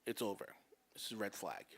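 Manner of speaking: imitating her low, hushed final word; really quiet. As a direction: drop low and soft, halting between phrases. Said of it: "just gets really quiet".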